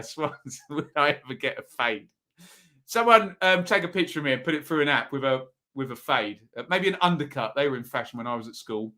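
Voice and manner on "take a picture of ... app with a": ordinary talk, nothing marked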